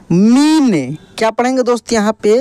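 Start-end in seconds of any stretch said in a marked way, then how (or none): 0.1-1.0 मीन with a long ī arching up and down; drawn-out long ī. Shown as a demonstration. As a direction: stretch out the ī in मीन. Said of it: "इसका उच्चारण खींच कर होता है". (मीन की ई खींच कर)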